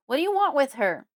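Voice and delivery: loud, high, forceful shout; yelling. A narrator's reading. Father yelling at silent Fred, protective. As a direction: shout the demand at full force. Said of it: "he yells again".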